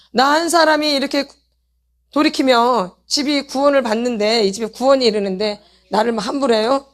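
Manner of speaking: ordinary talk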